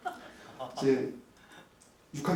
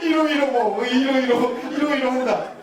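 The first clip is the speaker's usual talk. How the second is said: higher, louder, laughing mock scolding; sarcastic jab; 9 semitones higher, 8 dB louder than usual. Husband retelling his sarcastic words to wife, laughing. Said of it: "제가 이렇게 얘기를 해버린 거예요".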